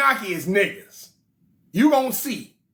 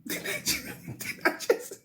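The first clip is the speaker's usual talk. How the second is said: sputtering whisper; 10 dB quieter than usual. Acted. [name] sputtering.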